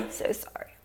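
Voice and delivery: voiceless whisper, voice giving out; breathy whisper, no voice left; losing her voice. Real, not acted. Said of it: "my voice is gone".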